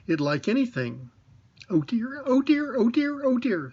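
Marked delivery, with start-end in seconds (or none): 1.7-3.7 high, squeaky little pig fretting; worried little piglet wringing his paws; fretful Piglet. A narrator's reading. Piglet frets to himself as he nears the trap, high and worried. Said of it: "said Piglet to himself".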